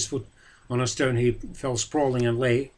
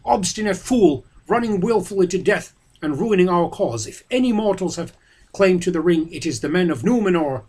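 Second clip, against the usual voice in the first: loud, higher, furious reading; angry Boromir; 6 semitones higher, 5 dB louder than usual. A narrator's reading. Boromir scolding Frodo, loud and furious. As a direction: rage at the stubborn halfling.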